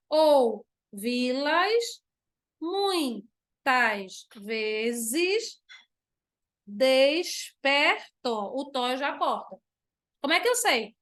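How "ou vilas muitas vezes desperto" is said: syllables stretched for scansion; slow verse reading. Shown as a demonstration. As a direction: read the line very slowly, counting. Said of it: "vou contar de novo". (leitura lenta para escansão)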